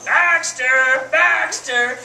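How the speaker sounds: calling the dog. Retold or imitated. Parrot calling the bored-time dog into the room, imitated loud call. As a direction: holler the name out like the bird summoning a dog. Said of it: "he would call the dog".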